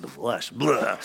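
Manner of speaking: low, soft, hammed-up creepy voice; mock spooky. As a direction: say it like a horror-movie line, lightly. Mock spooky reaction of people.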